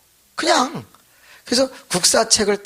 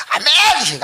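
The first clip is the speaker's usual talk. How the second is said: a high, loud, crying-out amen; 13 semitones higher, 6 dB louder, much slower than usual. Retold or imitated. Congregation crying out at the prayer meeting, loud wail. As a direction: wail the amen like a frenzied worshipper.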